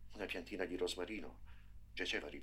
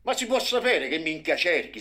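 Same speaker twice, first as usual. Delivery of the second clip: high, loud raging yell; father bellowing at his son in the rubble; furious bawling; 10 semitones higher, 15 dB louder than usual. A narrator's reading. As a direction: bawl at your son, neck veins bulging. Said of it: "sbraitò suo padre".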